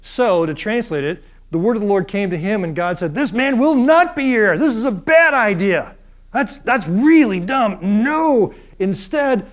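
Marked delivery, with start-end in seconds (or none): 3.1-9.5 higher, louder, emphatic rebuke; shouting in all caps. Acted. God rejecting Abraham's heir plan, shouting. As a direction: thunder the refusal like a shout in capitals. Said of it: "sounds like someone's shouting at you".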